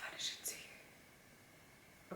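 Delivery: slow, breathless whisper of the title; awed whisper. Real, not acted. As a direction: barely breathe the name. Moved to tears by song.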